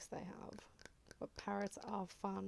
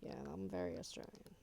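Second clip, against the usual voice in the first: drops much lower on "I'm very Australian"; deep, hammed-up take on her own accent; mock Aussie voice; 6 semitones lower than usual. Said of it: "I'm like".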